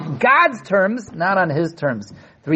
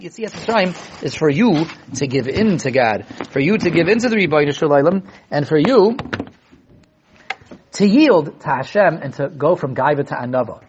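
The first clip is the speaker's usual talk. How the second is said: normal talking voice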